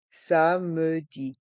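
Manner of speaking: "Samedi" drawn out slowly as sa-me-di; syllable by syllable. Shown as a demonstration. Teacher breaking "Samedi" down for a listening quiz, slow separate syllables. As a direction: say "Samedi" at half speed, sounding the middle e. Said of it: "Écoutez et choisissez".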